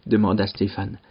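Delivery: plain reading voice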